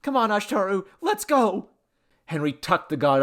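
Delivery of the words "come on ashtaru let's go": high, excited rallying call; eager urging. A narrator's reading. Man rushing the god out, eager. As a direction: hurry him along gleefully.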